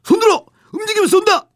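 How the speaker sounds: high, loud barked command; shouting sentry. Acted. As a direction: shout it like a guard on duty. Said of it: "굵고 짧은 외침이 들렸습니다".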